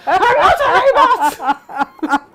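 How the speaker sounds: slow, high, loud shout with a laugh; exasperated shout. Retold or imitated. Prank victim yells at the coffee machine, slow and loud. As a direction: yell it at the stubborn machine. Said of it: "And he was just like".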